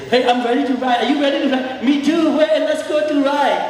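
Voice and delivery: chirpy play-acted chat between horse and rider; playful horse voice. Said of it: "by standing and say".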